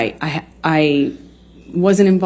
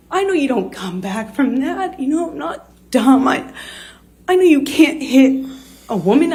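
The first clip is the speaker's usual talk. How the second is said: higher, strained voice close to tears; fighting back tears while telling it; tearful, voice breaking; 6 semitones higher than usual. Real, not acted. Telling how her partner hit her, through real tears. Recounting being slapped, emotional.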